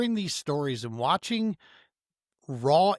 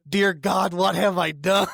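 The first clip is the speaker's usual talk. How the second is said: loud, higher comic lament breaking into a laugh; hammed-up cry of regret, played for laughs; mock dismay; 5 semitones higher, 6 dB louder than usual. Loading a tank of gas in my bass boat, mock dismay.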